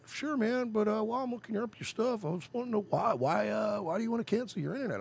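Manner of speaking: high, eager sales rep voice; retention rep voice. Retold or imitated. Imitating the Frontier rep on the phone, high and eager.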